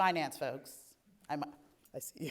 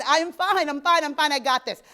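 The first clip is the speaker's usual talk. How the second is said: loud, higher, overinsistent claim of being fine; false confidence; 6 semitones higher, 9 dB louder than usual. Retold or imitated. Speaker recalling her denial to the audience, loudly overconfident. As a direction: insist you're fine when you are not. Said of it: "'cause I am not okay".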